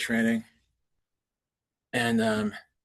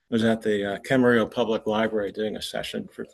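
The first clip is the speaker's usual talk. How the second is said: ordinary talk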